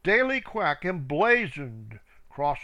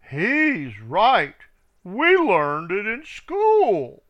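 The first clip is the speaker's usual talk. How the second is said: slow, louder ducklings backing him up; louder ducklings chiming in; 5 semitones higher, 7 dB louder, slower than usual. A narrator's reading. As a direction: side with him loudly and slowly. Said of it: "Up quacked".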